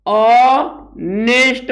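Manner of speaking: ऑनेस्ट slowly split as "ऑ... नेस्ट", no h; silent h, syllable by syllable. Shown as a demonstration. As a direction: hold the opening vowel of ऑनेस्ट, then pause. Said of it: "यच silent होता है".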